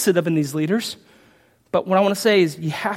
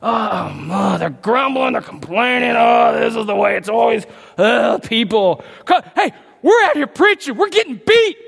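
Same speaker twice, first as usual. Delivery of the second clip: whiny complaining apostles; 5 semitones higher, 5 dB louder than usual. Retold or imitated. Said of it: "it was like".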